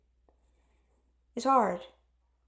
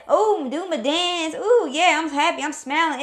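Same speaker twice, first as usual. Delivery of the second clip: mock happy boasting; 5 semitones higher, 6 dB louder than usual. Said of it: "all that".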